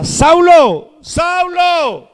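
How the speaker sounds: high, drawn-out call of the name; ringing high summons; voice from heaven. Acted. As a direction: call him from on high, pitched well up. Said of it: "oyó una voz que le decía".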